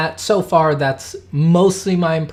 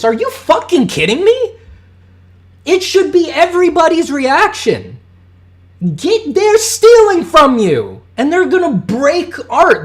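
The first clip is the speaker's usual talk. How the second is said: much higher, louder furious yelling; furious shout; 13 semitones higher, 6 dB louder than usual. Real, not acted. Ranting streamer yells in outrage at AI companies stealing from artists. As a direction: shout it out, outraged that artists are being robbed.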